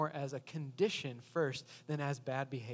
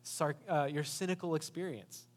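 sarcastic; 6 dB louder than usual. Retold or imitated. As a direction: lay the sarcasm on thick at the start. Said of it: "she says very sarcastically".

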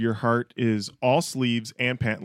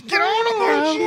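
high falsetto; 4 dB louder, slower than usual. Pretend. Mock singing voice.

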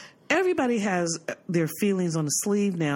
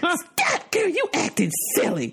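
mother scolding; 11 semitones higher, slower than usual. Retold or imitated. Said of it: "She's like".

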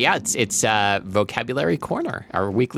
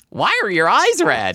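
put-on high girly voice; 10 semitones higher, 4 dB louder, slower than usual. Ella voiced in story.

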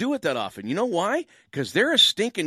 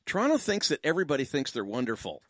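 usual speaking voice

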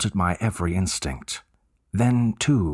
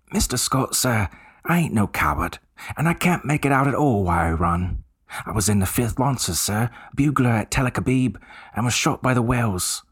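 higher Cockney voice of an eager bellboy; 4 semitones higher than usual. Acted. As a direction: play the chatty Cockney servant, keen to help.

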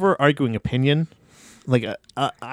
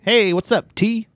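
higher-pitched casual hello; imitated breezy hello, pitched up; breezy cover-up greeting; 7 semitones higher, slower than usual. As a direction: toss out a chummy greeting to dodge the name. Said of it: "I'll just go like".

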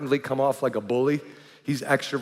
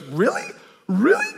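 rising higher with each really; second really much higher and louder; stunned disbelief; 8 semitones higher, 3 dB louder than usual. Retold or imitated. Friend hearing examples of his bullying, rising disbelief. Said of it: "and he goes".

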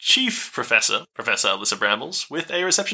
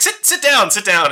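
high, loud insistent command; urgent order; 8 semitones higher, 7 dB louder than usual. Acted. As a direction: snap them into the chairs. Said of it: "She's like".

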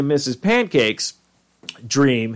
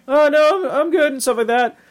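very high, squeaky refusal; Morty; 14 semitones higher than usual. Retold or imitated. Imitated boy turning down a stranger's advances, high and uneasy. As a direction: imitate a nervous boy backing away.

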